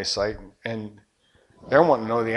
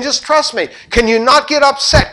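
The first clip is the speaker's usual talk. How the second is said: high and loud, leaning on "trust"; emphatic loud insistence; 12 semitones higher, 7 dB louder than usual. Retold or imitated. Preacher booming God's promise at the congregation, emphatic. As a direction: proclaim it to the room, pressing hard.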